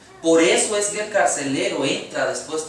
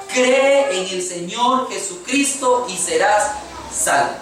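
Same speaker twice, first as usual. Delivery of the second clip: slow, raised preaching voice, words stretched out; emphatic proclamation; 4 semitones higher, 4 dB louder, slower than usual. Acted. Preacher giving the congregation Paul's answer, emphatic proclamation. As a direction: proclaim it slowly, higher and louder.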